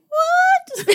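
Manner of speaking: a sudden squeal several notes up, laughing; squeaky high what. Retold or imitated. Flustered at a compliment, a laughing high squeal. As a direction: shoot up into a delighted squeak. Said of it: "our voices go up, like, three octaves".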